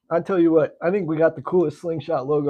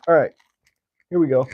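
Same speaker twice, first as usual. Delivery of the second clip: ordinary talk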